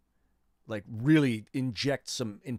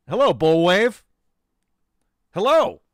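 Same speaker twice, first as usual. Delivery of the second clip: excited greeting; 10 semitones higher, 15 dB louder than usual. Real, not acted. Greeting a newcomer loudly.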